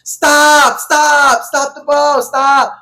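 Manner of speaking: high, loud cries of stop; shouted high in repeated bursts; retold panicked yelling. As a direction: yell it out like you're begging the driver to stop. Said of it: "I start hearing".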